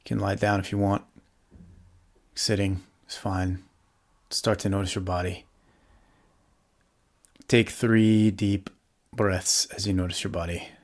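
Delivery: calm, softly settling meditation voice with long pauses; guided meditation. Real, not acted. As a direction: guide them gently into the body, leaving space to breathe.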